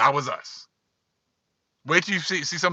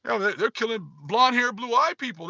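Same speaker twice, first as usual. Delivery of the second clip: higher whiny mock lament; mock crying; 5 semitones higher than usual. Mocking how they cry to the audience, high whiny mock lament. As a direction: mimic their self-pitying wail, hammed up. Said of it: "and they just crying".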